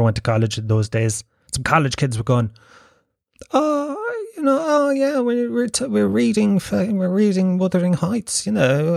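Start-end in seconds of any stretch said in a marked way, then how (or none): 3.5-9.0 high-pitched mock Liverpool accent; exaggerated Liverpool accent, sliding down from high pitch. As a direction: put on a sing-song Liverpool student voice, starting very high. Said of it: "put on a fucking Liverpool accent".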